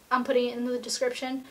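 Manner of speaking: ordinary talk, nothing marked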